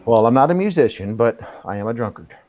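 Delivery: ordinary delivery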